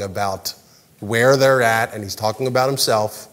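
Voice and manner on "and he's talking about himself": ordinary talk, nothing marked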